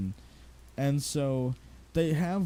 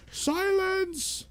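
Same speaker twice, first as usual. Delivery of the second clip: much higher, booming mock shout on Silence; Dumbledore shouting silence; 12 semitones higher, much slower than usual. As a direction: bellow Silence like an old wizard calling order. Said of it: "right after he's like".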